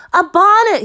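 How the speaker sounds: put-on high, loud child's cry; excited cry. A narrator's reading. Caleb on the porch roof, loud excited cry. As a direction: shout it out, thrilled. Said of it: "he cried".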